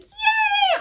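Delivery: high, loud squeal of joy; squealed yay. Retold or imitated. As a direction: shriek it like a delighted fan. Cheering when he gets up.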